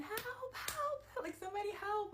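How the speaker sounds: frantic high yells for help; screaming for help. Retold or imitated. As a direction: call out desperately for anyone to hear.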